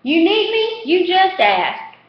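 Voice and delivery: high, slow eager offer; eager street kid. A narrator's reading.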